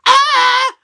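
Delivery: high, loud yell; sudden high scream. Retold or imitated. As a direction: scream like a wrestler flying. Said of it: "X-Pac was like".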